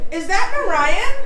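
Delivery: lightly voiced small child asking, slow; a hint of a curious toddler; imitated little girl. Mother imitating her daughter hearing the podcast, light child voice. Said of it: "and she goes".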